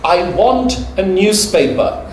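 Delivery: a non-native customer bluntly demanding a newspaper; blunt foreign customer. Retold or imitated. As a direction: lay on the awkward formality, then land on want. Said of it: "you go".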